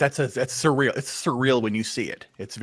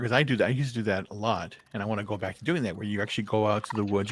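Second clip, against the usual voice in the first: normal talking voice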